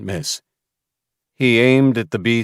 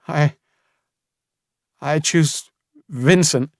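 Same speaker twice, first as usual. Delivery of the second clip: halting, slow, higher and broken; voice cracking; 7 semitones higher, slower than usual. A narrator's reading. Samuel forced to choose a child to die. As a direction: force out the name through a breaking voice. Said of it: "Voice cracking".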